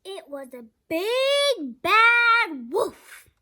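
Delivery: big bad wolf, slow and loud; dramatic reveal. A narrator's reading. Narrator revealing the wolf to the kids, slow and loud. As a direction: draw out the reveal with suspense. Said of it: "Do you know what that is".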